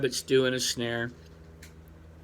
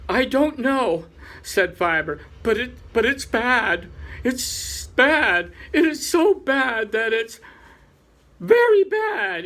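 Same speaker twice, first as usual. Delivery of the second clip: higher, rising worry to a peak on very bad; fretting, voice climbing higher; anxious Fiber; 7 semitones higher than usual. Fiber frets to the Chief Rabbit, higher and anxious.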